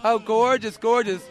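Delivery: ordinary talk, nothing marked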